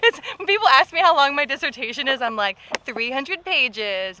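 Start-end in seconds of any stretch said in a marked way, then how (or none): 2.8-4.2 very slow, stretched-out reply; drawn-out answer. Retold or imitated. Author admitting her dissertation's length, drawn-out answer. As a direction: drag out each word of the reply.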